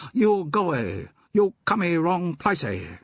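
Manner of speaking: high put-on pidgin English, adding "y" to words; mock pidgin accent. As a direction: keeper shooing the visitors away in fake pidgin. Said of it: "Do you have to talk like that?".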